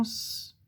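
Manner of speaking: long sustained hiss for "S"; pure held sss on "S"; isolated S sound. Shown as a demonstration. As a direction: say "S" as a drawn-out sss, not the letter name. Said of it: "qui fait le son S".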